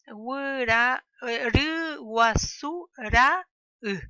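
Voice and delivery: Wura, ru, wasu, ra, u spoken with a clean stop between the vowels; syllables split by the puso pause. Shown as a demonstration. Teacher sounding out puso words for the class, loud and clipped between vowels. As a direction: break each word at the glottal stop, loud and slow. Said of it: "Se pronuncia la A y luego pausa u".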